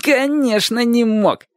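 high, emphatic jeer; confident. A narrator's reading. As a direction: assert it with total certainty. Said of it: "уверенно ответил Шурик".

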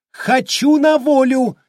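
very high, loud, slow hysterical cry; hysterical scream. A narrator's reading. Miss Raise screaming hysterically to be freed. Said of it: "истерично закричала мисс Райсе".

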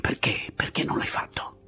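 breathy whisper, pressing; hushed questioning. A narrator's reading. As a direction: whisper it as a secret demand.

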